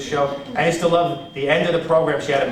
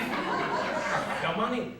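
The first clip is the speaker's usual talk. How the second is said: put-on softer, higher, zoned-out old woman; Aunt Lil; 4 semitones higher, 7 dB quieter, much slower than usual. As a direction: repeat it faintly like a confused patient. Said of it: "my Aunt Lil would sit there like this".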